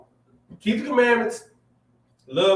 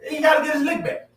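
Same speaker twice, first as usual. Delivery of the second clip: excited shout; 11 semitones higher than usual. Real, not acted. Using the new slang term, shouted high. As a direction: shout it with glee. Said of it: "that's the new term".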